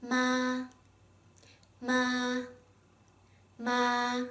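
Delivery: each MA flat and high; first tone. Shown as a demonstration. Teacher drills the first tone, MA high and flat. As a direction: hold every MA level and high-pitched. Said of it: "flat and high-pitched tone".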